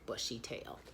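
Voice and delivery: ordinary delivery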